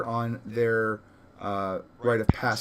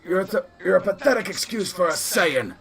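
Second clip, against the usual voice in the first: raised, higher-pitched scornful shout; yelled taunt; 7 semitones higher, 3 dB louder than usual. Acted. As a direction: yell the put-down across at him. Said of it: "yell out to him".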